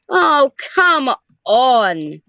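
slow, stretched-out groan of frustration; exasperated drawl. Real, not acted. Player fed up with noisy neighbours, exasperated. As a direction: let the come on sag in exasperation.

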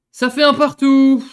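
high, loud, slow disbelieving wail; anguished loud wail; outraged cry. Real, not acted. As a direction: cry out in despair at the goal. Conceding equaliser.

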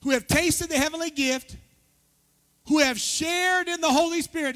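high, projected pulpit reading; scripture reading. Acted. Pastor walks the church through Hebrews, proclaiming it. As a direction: proclaim the verse to the room. Reads scripture aloud to congregation.

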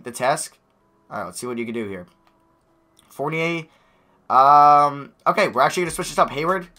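usual speaking voice

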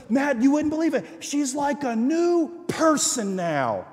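high and thrilled, slowing at the end; excited. Retold or imitated. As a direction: savour the wonder of it. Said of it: "she goes".

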